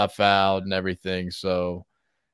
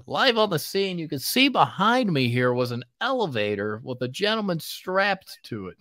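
news anchor voice; 7 semitones higher than usual. Pretend. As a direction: report live from the scene. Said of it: "you got that, uh, news anchor voice down pat".

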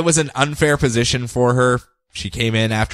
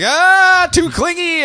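exasperated escape; 4 dB louder, slower than usual. Acted. Acted quote fleeing a clingy man.

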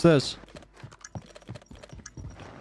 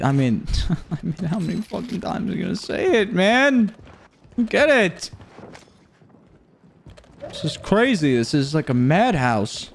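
ordinary talk, nothing marked

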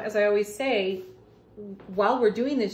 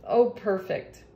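usual speaking voice

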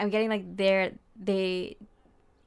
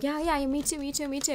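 eager agreeing; 7 semitones higher than usual. Retold or imitated. Partner eagerly agreeing, faking readiness.

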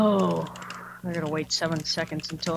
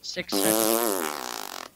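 much lower and very slow; deep slow voice; 11 semitones lower, much slower than usual. Pretend. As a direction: drag it out low. Drawls the countdown.

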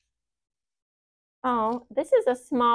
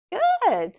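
high, bright good; cheerful praise; 13 semitones higher, 3 dB louder than usual. Real, not acted. Teacher praising the right guess, high.

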